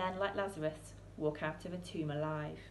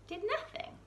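much higher, disbelieving repeat of nothing; incredulous echo. A narrator's reading. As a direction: echo it as if you can't believe it.

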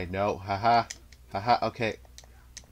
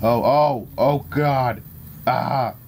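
loud, higher-pitched panicked exclamations; alarmed cries; 4 semitones higher, 4 dB louder, much slower than usual. Real, not acted. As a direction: cry out as the fight turns bad. Losing control in the fight.

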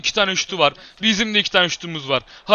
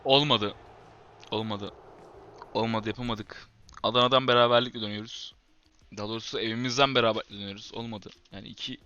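much softer, lower, dejected murmur; quiet defeated sigh of words; 1 deflated; 10 semitones lower, 13 dB quieter, faster than usual. Real, not acted. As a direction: let the disappointment drain the voice. After draw, dejected.

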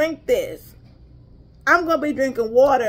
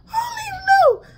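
much higher, exasperated outburst; exclaiming; faster than usual. Real, not acted. To viewers unsure if she tried it, exclaiming. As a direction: throw your hands up vocally.

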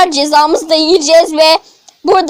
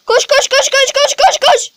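panicked urging; 7 semitones higher than usual. Real, not acted. Fleeing Granny in panic.

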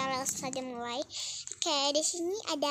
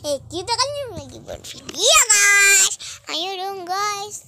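loud; 6 semitones higher, 11 dB louder than usual. Real, not acted. Loud reminder to viewers.

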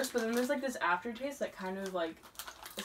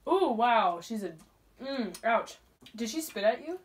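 chewing while talking; mouth full. Real, not acted. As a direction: hand over mouth. Seen 0: Both eat sliders throughout; left covers full mouth, speaker uncertain.

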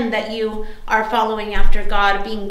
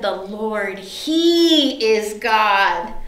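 crowd's reverent declaration; 3 semitones lower, much slower than usual. Retold or imitated. People on their knees confessing God, slow and reverent. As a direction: stretch each word in hushed awe. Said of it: "and they said".

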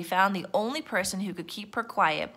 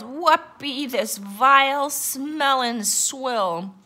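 slow, higher, disgusted pirate captain; yelling pirate; 5 semitones higher, 4 dB louder, much slower than usual. A narrator's reading. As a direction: draw out the pirate's outrage. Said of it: "he yelled".

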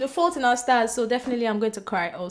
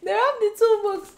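high, loud incredulous repeat; giggly disbelief at the wrapped books; amused disbelief; 11 semitones higher, 4 dB louder than usual. Real, not acted. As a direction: say it again in laughing disbelief.

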